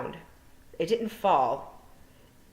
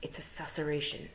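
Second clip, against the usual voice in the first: hushed; 8 dB quieter than usual. Acted. Tiffany hushed.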